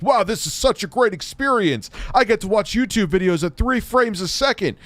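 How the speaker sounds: high, gushing fake wonder; overjoyed, sarcastic; mock amazed. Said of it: "you were still like".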